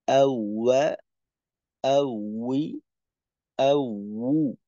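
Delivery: ওয়া উই উ said with round lips and a little air; rounded Arabic W drill. Shown as a demonstration. Teacher drilling the W letter, rounded lips. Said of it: "উচ্চারণটা হয়".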